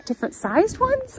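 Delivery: high-pitched, questioning echo of a request; mock incredulous. Employee questioning a request for carabiners, high mock incredulity. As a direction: imitate a puzzled employee, pitch leaping up.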